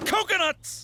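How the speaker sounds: high, thrilled cry of coconuts; excited exclamation. Acted. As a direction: blurt it out with sudden glee. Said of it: "They have coconuts".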